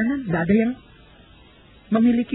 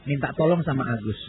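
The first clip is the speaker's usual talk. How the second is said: ordinary talk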